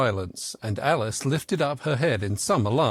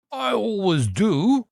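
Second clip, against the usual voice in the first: higher, slow, louder knight voice; the Red Knight; 7 semitones higher, 4 dB louder, slower than usual. A narrator's reading. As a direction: answer proudly and deliberately. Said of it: "said the Red Knight".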